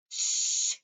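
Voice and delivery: loud unvoiced shush; whisper. Others hush the centipede in a whisper. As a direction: hush him urgently. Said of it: "the others whispered".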